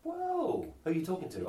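high, startled exclamation; shocked whoa. Real, not acted. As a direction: jump at the rude word. Reacting to a swear word.

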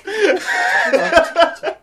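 loud rising laugh; high laugh. Real, not acted. Laughing at joke.

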